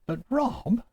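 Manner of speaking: high-pitched imitation of a student objecting; mock objection, pitched well up. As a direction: put on a student's high protest. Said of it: "you will tell me".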